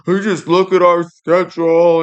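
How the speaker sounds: sarcastic coach impression; mimicking Nick Saban. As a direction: parody the coach complaining. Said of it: "gets on T V and bitches about how".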